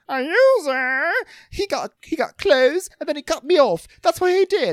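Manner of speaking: very high, loud squealed indignation; hammed-up falsetto tantrum; mock outrage. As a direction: imitate an affronted man shrieking his defence. James outraged at being called user.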